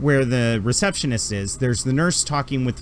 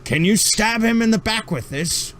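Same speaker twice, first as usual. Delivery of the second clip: much higher, fussy put-upon doctor voice; grumpy alien doctor; 9 semitones higher than usual. Acted. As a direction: huff like an overworked old doctor. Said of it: "he's like".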